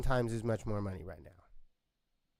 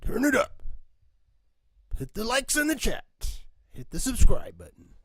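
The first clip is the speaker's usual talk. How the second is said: higher, louder hype wrestler growl; 12 semitones higher, 9 dB louder than usual. Acted. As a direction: bark it like a pro wrestling promo. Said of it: "And my terrible wrestler voice".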